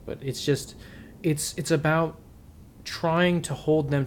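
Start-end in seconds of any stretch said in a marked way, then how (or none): none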